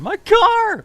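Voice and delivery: shrill high mock cry of dismay; mock wail. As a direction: wail "my car" in a hammed-up voice. His car just got wrecked.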